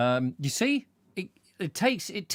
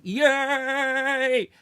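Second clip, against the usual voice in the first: high, loud "Yay!" like a released crowd; cheering Spaniards; 10 semitones higher, 5 dB louder than usual. Retold or imitated. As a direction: cheer like people let out of lockdown. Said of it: "they've all gone".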